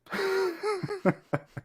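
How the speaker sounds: high-pitched squealing laugh; real laugh. Real, not acted. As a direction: burst out in a high, helpless laugh. Laughing at his own story.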